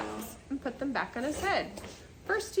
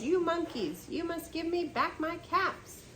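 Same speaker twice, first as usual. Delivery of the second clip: shouted; 7 semitones higher than usual. Acted.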